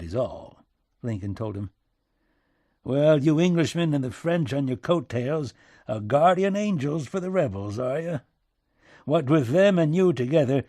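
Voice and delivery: put-on back-country accent, heated then resigned; agitated President Lincoln, sardonic. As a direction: needle him, then grudgingly concede.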